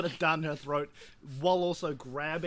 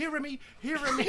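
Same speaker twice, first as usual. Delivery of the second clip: high, sing-song call to a pet; 11 semitones higher than usual. Acted. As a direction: coax the rat over like a dog. Player coaxing rat like dog.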